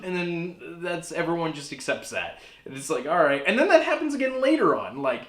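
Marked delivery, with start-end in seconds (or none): none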